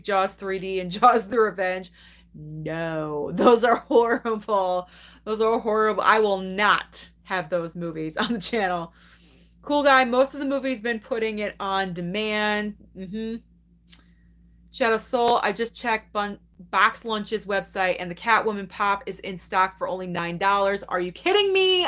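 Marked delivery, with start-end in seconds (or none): 2.3-4.9 slow disgusted dismissal. Real, not acted. To chat about bad Jaws sequels, slow disgusted drag. 20.8-21.8 can't believe the good luck; thrilled disbelief. Real, not acted. To chat about the nine-dollar Catwoman Pop, thrilled disbelief.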